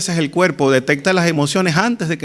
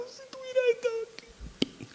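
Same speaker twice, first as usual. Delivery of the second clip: spent, whispered sob; crying, voice giving out; 16 dB quieter than usual. Acted. Preacher as weeping sister to Jesus, voice collapsing in tears. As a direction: let the wail collapse into an airless whisper. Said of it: "y llorando".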